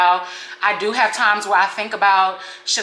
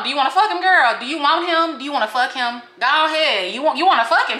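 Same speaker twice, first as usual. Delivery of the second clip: high, mocking dare; taunting sass; 6 semitones higher, 3 dB louder than usual. Vlogger taunting the snitch who wants her ex, sassy high dare. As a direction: goad her with sassy mock offers.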